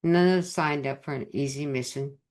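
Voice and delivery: ordinary delivery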